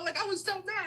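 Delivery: ordinary talk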